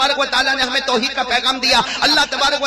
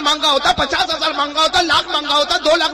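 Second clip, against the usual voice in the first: Rashid Khan mocking; 5 semitones higher than usual. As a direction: shout it as the rich man teasing the qari over five rupees. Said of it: "Rashid Khan کیا کہے گا؟".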